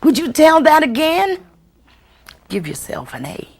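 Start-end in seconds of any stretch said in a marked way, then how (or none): 0.0-1.4 put-on high, excited child's voice begging for more; delighted child calling out for another telling; eager child. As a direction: sound like a kid who loved the story. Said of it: "one of them will say".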